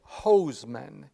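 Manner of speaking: "hoseman" with the first part like a fire hose; slow "hoseman", opening just like "hose"; hose-like pronunciation. Shown as a demonstration. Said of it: "I would pronounce it like the".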